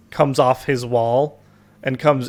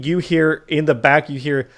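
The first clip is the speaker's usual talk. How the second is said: ordinary delivery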